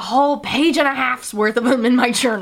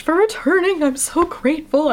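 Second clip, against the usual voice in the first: breathy voice; 7 semitones higher than usual. Real, not acted. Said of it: "I don't know why I'm using this breathy voice".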